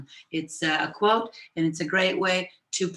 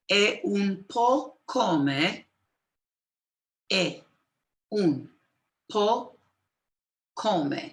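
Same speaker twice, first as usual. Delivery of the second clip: "è un po' come" split with pauses between words; broken into pieces; 2 semitones higher, much slower than usual. Shown as a demonstration. Teacher dictating word by word to students, paused. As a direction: give "è", "un", "po'", "come" one at a time. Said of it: "I'll break it into pieces".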